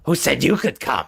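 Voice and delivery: goblin voice, confrontational; challenging goblin. Acted. Goblin pointing a spear at Kalia, challenging. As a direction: challenge the intruder as a suspicious goblin.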